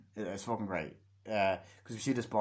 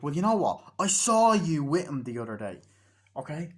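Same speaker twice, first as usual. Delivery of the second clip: loud, higher confrontation; heated, raised-voice challenge; acted accusation; 5 semitones higher, 7 dB louder than usual. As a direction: throw the accusation in their face. Accusation in retold quarrel.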